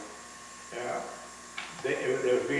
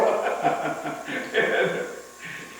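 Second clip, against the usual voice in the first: rising laugh; 6 semitones higher than usual. Real, not acted. Laugh alone on line.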